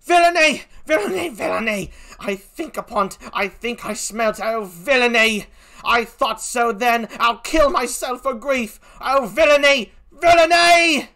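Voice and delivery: high cries of outrage; grief-stricken, building to a loud wail; anguished Emilia. Acted. Emilia realizes the villainy, crying out in anguish.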